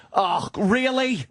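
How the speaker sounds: high, drawn-out exasperated groan of words; acted-out irritated "really"; exasperated sound-making. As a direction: stretch it out high, openly fed up. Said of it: "I, I really make sounds".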